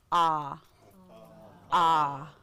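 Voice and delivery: slow, isolated "Ah", held open; demonstrated ah sound. Shown as a demonstration. Teacher showing the letter A's sound, demonstrated "Ah". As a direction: sound out "Ah" as a drawn-out vowel. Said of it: "makes an ah sound".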